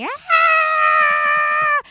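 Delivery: 高声尖叫，模仿妻子分娩时的惨叫; retold scream. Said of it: "She was screaming".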